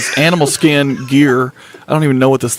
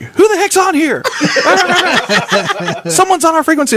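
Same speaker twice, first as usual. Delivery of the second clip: squeaky, much higher voice broken by laughter; imitating Julie's panicked squeal, laughing through it; mock Julie. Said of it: "Julie's like".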